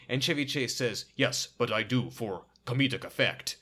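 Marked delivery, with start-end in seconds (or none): 1.1-3.6 mock F Troop Native accent. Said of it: "they all talk like this".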